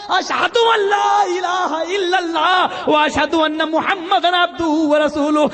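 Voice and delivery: high, slow, shouted declaration of faith; shouted kalima. Retold or imitated. Hurmuzan calling out the kalima from afar, slow shout. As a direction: call it out slowly, full voice. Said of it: "Hormuzan وہیں سے چیختا ہے".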